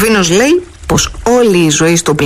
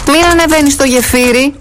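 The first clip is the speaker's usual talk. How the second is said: calling out; 8 semitones higher than usual. Acted. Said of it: "του φώναξε από ψηλά".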